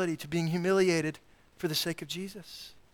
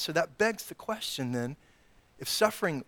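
ordinary talk

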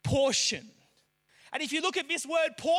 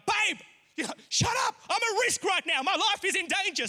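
mad; 7 semitones higher than usual. Retold or imitated. Choking husband snaps at laughing wife, high angry yell. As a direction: play the panicked angry husband yelling shut up. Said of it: "And so I get real mad".

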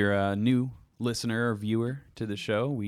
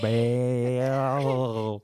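drawn-out, louder Bale; overdone; 4 semitones higher, 5 dB louder than usual. Acted. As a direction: ham up the name. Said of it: "I'm overdoing it".

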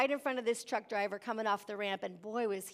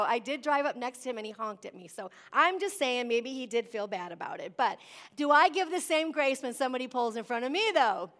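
ordinary talk, nothing marked

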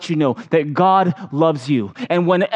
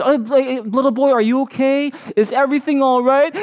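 a touch of a higher, friendly clerk voice; kind store clerk; 8 semitones higher than usual. Retold or imitated. Store staff member asks a lost boy if he's okay, lightly voiced. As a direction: lightly voice a helpful clerk checking on a kid. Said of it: "a staff member from the grocery store comes up to me".

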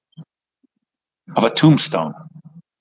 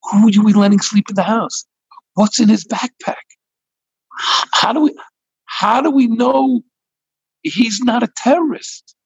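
high-pitched, worried protest; alarmed wife; 8 semitones higher than usual. Acted. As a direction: challenge your husband in a raised, anxious voice. Alarmed wife challenges her husband.

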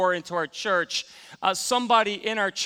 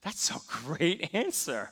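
whispered wow, then a soft laugh; hushed awe; 6 dB quieter, much slower than usual. Retold or imitated. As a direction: breathe it out in quiet amazement. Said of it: "I'm thinking".

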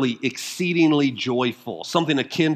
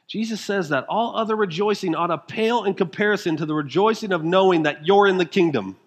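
ordinary talk, nothing marked

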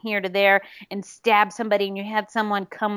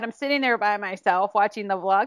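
ordinary talk, nothing marked

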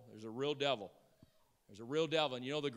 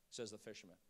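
normal talking voice

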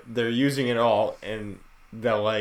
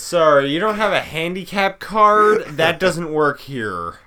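loud, higher snide agent; mocking gate agent; 6 semitones higher, 7 dB louder than usual. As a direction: sneer at the passenger. Acting rude agent.

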